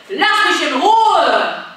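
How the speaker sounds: loud, slow, firm refusal; loud leave me alone. Shown as a demonstration. Coach modelling a loud refusal for the kids. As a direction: push them away with your voice. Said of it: "in der letzten Runde".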